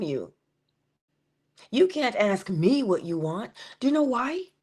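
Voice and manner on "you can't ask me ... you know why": ordinary talk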